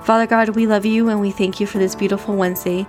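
ordinary talk

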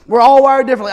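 punched out loud, stress on ALL; preacher hammering the point home; loud emphatic repeat. Acted. Repeated, much louder.

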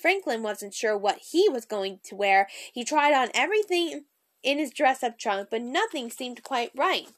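plain reading voice

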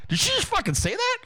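can't believe what he heard; incredulous. Retold or imitated. Repeating his thought about the staffer's praise, incredulous.

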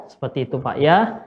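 ordinary delivery

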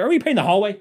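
husband snapping at wife mid-paint, loud and high; snappy retort. Retold or imitated. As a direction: bite back sarcastically.

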